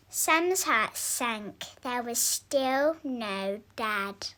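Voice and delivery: usual speaking voice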